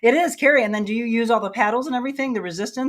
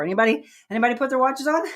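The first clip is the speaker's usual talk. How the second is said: usual speaking voice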